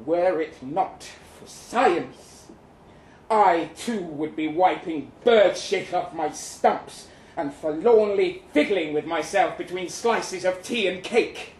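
usual speaking voice

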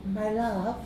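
put-on soft Greek girl. A narrator's reading. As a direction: say it gently like a shop girl. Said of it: "in a soft voice".

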